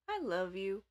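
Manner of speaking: heavy sigh, then a tipsy "I love you"; drunk sister's declaration. Retold or imitated. Drunk sister to her helper in the bathroom, sighing affection. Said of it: "She was the "I love you" person".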